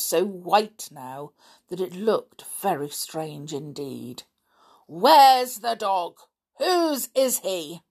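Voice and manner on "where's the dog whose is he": loud, high, drawn-out questions; angry demand. A narrator's reading. Stepfather interrogating the children about the dog, angry demand. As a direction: demand answers, slowly and forcefully.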